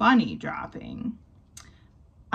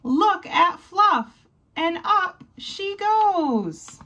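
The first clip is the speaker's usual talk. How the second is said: higher, louder, slow wonder; excited narration; 10 semitones higher, 3 dB louder, slower than usual. A narrator's reading. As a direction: marvel as Fluff flies up. Climax of rescue narration.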